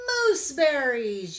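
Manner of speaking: loud, excited kitten shout; joyful yell. A narrator's reading. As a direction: yell out the answer with glee. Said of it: "yelled Leah and Andy".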